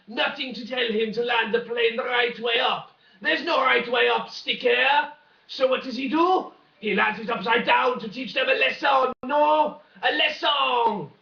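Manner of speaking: high, shouted hammed-up French accent; mock French accent. French captain landing upside down, shouted mock French accent. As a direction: bellow it as an indignant French pilot with a French accent. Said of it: "I wanna do the French accent a bit more".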